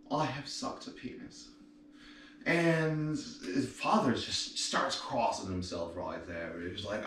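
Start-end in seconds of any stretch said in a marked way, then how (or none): 0.1-1.5 mock-ashamed whispered confession; devastated whisper. Third nun whispering her shameful confession to the priest. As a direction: breathe it out in shame. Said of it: "absolutely devastated".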